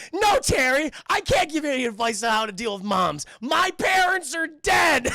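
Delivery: high, very loud hammed-up shout; over-the-top wounded bellow; anguished outburst. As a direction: yell it as comic melodrama, stretching the last words. Acted Bruce's outburst about dead parents.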